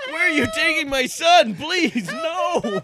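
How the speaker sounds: high panicked plea. Acted. Father pleads for son.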